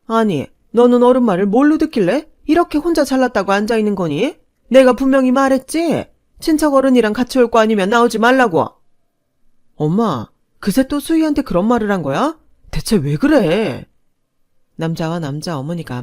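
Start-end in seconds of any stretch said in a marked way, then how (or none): 0.1-8.7 shrill, loud scolding mother; furious mother berating the young woman, high and loud; angry mother. Acted. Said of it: "화를 내더군요". 9.7-13.9 arguing son. Acted. Son arguing with his mother.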